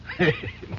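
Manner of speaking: a quick high chuckle; short laugh. Acted. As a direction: laugh off the drunk friend's lapse. Guest laughs at drunk host.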